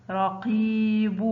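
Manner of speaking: رقيب slowly, قي held long; drawn-out example word. Shown as a demonstration. Teacher showing pupils the long vowel, slow and stretched. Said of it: "ينطق قي".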